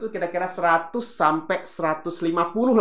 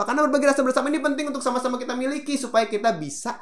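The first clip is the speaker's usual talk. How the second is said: lurching fast again; 11 semitones higher, faster than usual. Shown as a demonstration. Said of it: "terlalu cepat tuh merubah kecepatan".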